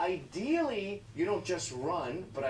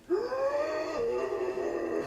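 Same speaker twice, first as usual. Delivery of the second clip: high, long mock gasp of horror; horrified gasp; 10 semitones higher, 3 dB louder than usual. Teacher spotting the clock, a hammed-up gasp. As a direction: gasp in exaggerated shock. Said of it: "the teacher just went".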